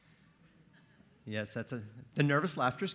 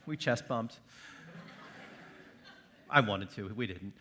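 normal talking voice

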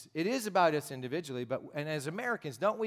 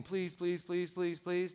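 mock pleading, repeated on one raised note; begging; 3 semitones higher, slower than usual. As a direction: imitate someone begging over and over. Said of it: "wasn't like begging".

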